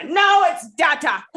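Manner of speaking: loud, high mock outburst insisting on "data" as DAT-ah; battling inner demon. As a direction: shout down the other demon, drawn out. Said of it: "Fighting with his inner demons".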